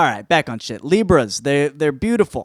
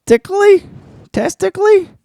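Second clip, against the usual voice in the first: squeaky; 6 dB louder than usual. Acted.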